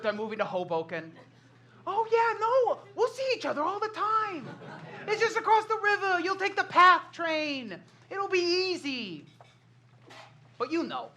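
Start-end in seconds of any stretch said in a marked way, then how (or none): 1.9-9.2 higher-pitched, breezily reassuring friend. Acted. Friend moving to Hoboken promises to keep in touch, breezy reassurance. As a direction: brush off the worry in a lighter, higher voice. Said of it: "conversations with that friend who tells us".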